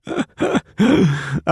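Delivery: high snorting laugh; snorting laughter. Acted. Dylan and Pacey snorting over their beers. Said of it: "Dylan and Pacey both snort".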